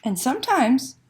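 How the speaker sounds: slow, lively picture book reading; reading to children. Acted. Librarian reading Una to children online, expressive storytelling. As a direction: read it like a bedtime story to little ones.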